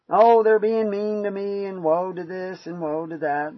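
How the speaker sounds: high, drawn-out mock whining; exaggerated griping, starting loud and high, easing off; whiny complaining. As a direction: whine it like a put-upon complainer. Voicing a whiner's complaint.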